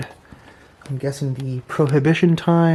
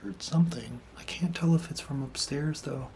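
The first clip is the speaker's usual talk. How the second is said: unvoiced, tense whisper; nervous night vlog whisper; 12 dB quieter than usual. Real, not acted. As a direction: whisper it, listening hard. Heard a noise, whispering.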